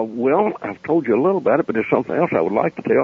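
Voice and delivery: normal talking voice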